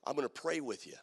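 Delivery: soft, gentle offer; soft quoted invitation. Retold or imitated. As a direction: say it quietly and kindly. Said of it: "and he'd say".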